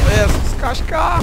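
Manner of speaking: high alarmed cry; startled shout. Real, not acted. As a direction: yelp in sudden fright. Explosion nearby in game.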